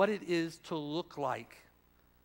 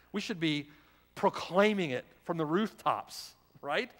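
ordinary delivery

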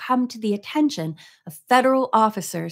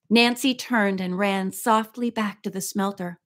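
ordinary delivery, nothing marked

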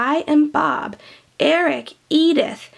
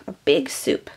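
normal talking voice

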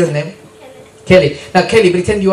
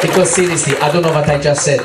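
normal talking voice

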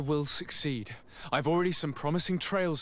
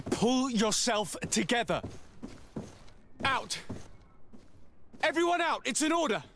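higher and forceful, commanding everyone out; sharp orders; 9 semitones higher than usual. Acted. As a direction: snap the room into obeying. Clears the room, commanding.

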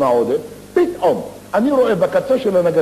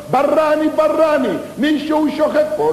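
shouting; 13 semitones higher than usual. Acted. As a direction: yell him awake once more. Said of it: "זה שצועק ככה".

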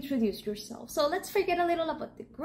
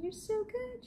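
high, soft gushing; gushing praise; 9 semitones higher, 9 dB quieter than usual. Real, not acted. Teacher gushing over her students. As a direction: stretch the so as you gush. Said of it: "gushing praise".

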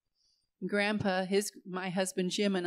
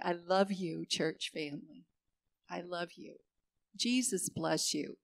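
tender; 3 semitones lower, 6 dB quieter than usual. Pretend. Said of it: "I'm rolling down the window and I'm putting out my hand and I'm going".

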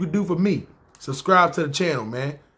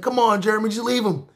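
lightly pitched-up coworker pleading; imitating Brian; 5 semitones higher than usual. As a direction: coax the boss, voice raised high. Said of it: "He's like".

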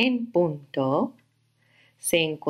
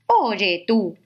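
grouchy ladybug; 3 semitones higher, 3 dB louder than usual. Acted. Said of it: "le dijo la mariquita".

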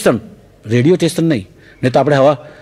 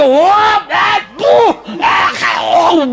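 mock retching; much slower than usual. Teacher learns what was in the sweet, retching in disgust. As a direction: heave and retch in high, strained bursts. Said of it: "સાહેબને તો ત્યાં ત્યાં ઉલટીઓ સુલટીઓ થઈ ગઈ".

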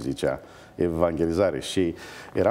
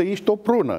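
punchline; 12 semitones higher, 4 dB louder than usual. Retold or imitated. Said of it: "Deci, în alte cuvinte".

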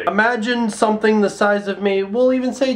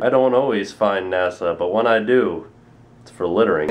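deep ad-parody voice; 10 semitones lower than usual. Pretend. Mocking a famous ad slogan with a low, suave voice. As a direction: deliver it like a smug commercial tagline.

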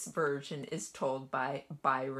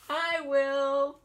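high, loud eager cat voice; eager meowing cat; 10 semitones higher, 9 dB louder than usual. A narrator's reading. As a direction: meow it out, keen to eat. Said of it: "meowed the cat".

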